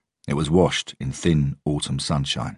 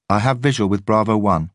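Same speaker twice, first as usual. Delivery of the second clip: radio report; 6 semitones higher, 5 dB louder than usual. A narrator's reading. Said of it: "said the same voice".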